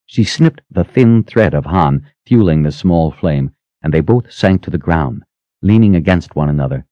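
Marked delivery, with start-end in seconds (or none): none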